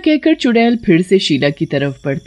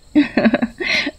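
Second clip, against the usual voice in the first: light high giggle; coy giggle; 6 semitones higher than usual. A narrator's reading. Rupa giggles at Raja's request, coy giggle. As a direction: giggle playfully.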